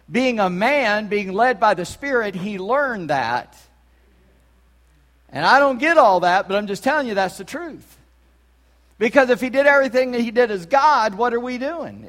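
full-force pulpit proclamation; impassioned preaching. Real, not acted. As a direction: preach with mounting force, driving every phrase.

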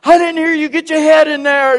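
loud, high barked demand; drill sergeant yell. Retold or imitated.

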